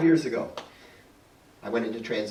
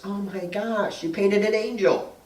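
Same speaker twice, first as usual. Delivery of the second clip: lightly voiced son, pitched higher; amazed son; 6 semitones higher than usual. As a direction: imitate a son's drawn-out amazement, lightly. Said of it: "my son comes down the stairs and he says".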